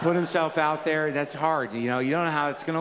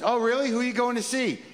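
eager curiosity; 8 semitones higher than usual. Retold or imitated. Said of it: "the guy ordering coffee goes".

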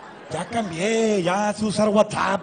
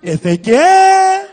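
drawn-out call; 13 semitones higher, 9 dB louder, much slower than usual. Retold or imitated. Calling for the missing ex.

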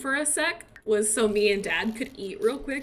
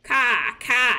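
car said British, cah with no r; 6 dB louder than usual. Retold or imitated. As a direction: toddler saying car the British way. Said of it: "he says car like a British person".

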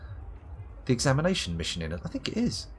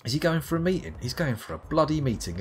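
normal talking voice

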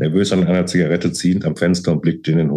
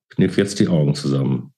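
usual speaking voice

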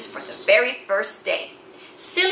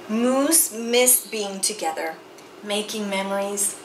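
ordinary delivery, nothing marked

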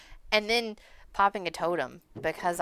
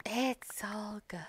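soft whisper; 2 semitones higher, 9 dB quieter, much slower than usual. Real, not acted. Reassuring whisper.

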